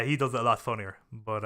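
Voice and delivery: ordinary delivery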